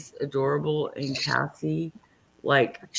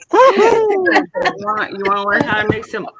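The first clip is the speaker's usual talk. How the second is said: higher, louder hyped host pitch; cooking show host; 7 semitones higher, 9 dB louder than usual. Acted. Opening her fudge video for friends, hyped host voice. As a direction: sell it like a TV chef. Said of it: "host-style intro to video".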